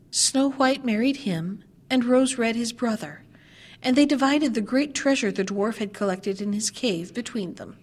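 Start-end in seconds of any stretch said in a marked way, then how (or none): none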